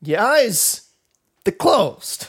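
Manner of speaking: high, halting celebrity impression; Christopher Walken. Repeating the line for a friend, exaggerated Walken impression. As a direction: push the pitch up, pausing mid-phrase.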